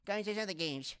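high-pitched mock complaint; mocking Cool Me. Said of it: "this is what Cool Me sounds like".

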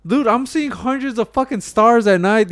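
loud, slow, amazed emphasis; stretched-out loud amazement; excited awe. Real, not acted. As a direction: savour every word, loud and in wonder. Awed about the stars at night.

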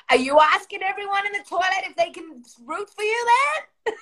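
high-pitched, incredulous demand; hammed-up rising question; mock interrogation. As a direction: imitate a friend catching someone out. Imitates Debbie catching her out.

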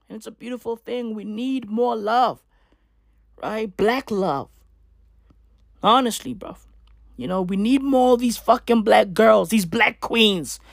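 ordinary talk

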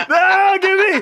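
high, loud comic yell; panicked mock shout. Boy demanding his eraser back, panicked mock shout. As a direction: yell it desperately, acting him out.

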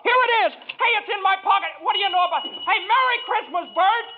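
high, giddy delight; overjoyed. Acted. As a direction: bubble over with joy. Finds the bell, overjoyed.

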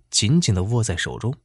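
plain reading voice

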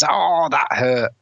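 slow, high, drawn-out whine of pain; mock pained whine. Hero after the taxi crash, a slow mock whine. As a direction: drag it out like a hammed-up hurt hero. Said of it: "and it's".

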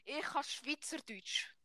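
slow, soft Swiss German demo. Shown as a demonstration. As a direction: say it slowly in Swiss German dialect. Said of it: "Das heisst".

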